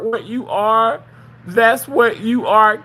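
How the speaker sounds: ordinary delivery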